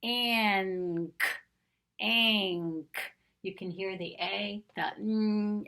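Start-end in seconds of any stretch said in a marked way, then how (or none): 0.0-3.0 ank said twice, clearly showing the A, N and K; ank sound. Shown as a demonstration. Teacher showing the word family sound, clear ank. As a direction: shape ank slowly with the mouth. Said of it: "watch my mouth".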